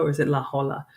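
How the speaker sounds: La Jolla said as La Hoya; La Jolla pronounced the Spanish way; soft J. Shown as a demonstration. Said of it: "how to pronounce it".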